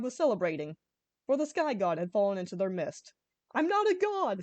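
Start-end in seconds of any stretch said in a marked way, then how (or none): 3.5-4.4 much higher desperate scream. A narrator's reading. Saddam tied up among dancing locals, screaming. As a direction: protest it in desperation. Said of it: "Saddam screamed".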